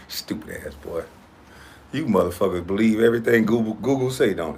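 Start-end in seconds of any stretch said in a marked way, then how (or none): none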